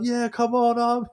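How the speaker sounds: high, pumped-up mock cheer; mock rallying cry. Teammate recalling cheering his team on, high and hammed. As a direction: gee up the army lads. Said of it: "I'm like".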